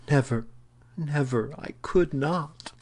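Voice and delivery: high-pitched, climbing protest; anguished refusal. A narrator's reading. Woman refusing to confess her shame, high anguished protest.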